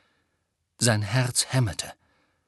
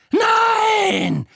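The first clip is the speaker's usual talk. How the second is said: very high, loud, long scream; scream of denial; 8 dB louder than usual. A narrator's reading. Witch refuses to believe Harry, screaming. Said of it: "schrie sie".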